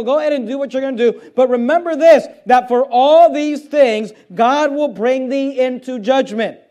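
full-voiced sermon build to judgment; preaching. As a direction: warn the young people from the pulpit. Preaching paraphrase to youth.

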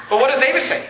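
ordinary talk, nothing marked

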